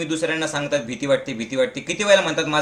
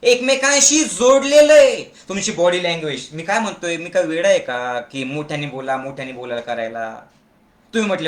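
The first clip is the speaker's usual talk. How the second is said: normal talking voice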